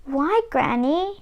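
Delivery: a worried little whisper; distressed whisper. Acted. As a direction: breathe it out in worry. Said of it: "was distressed".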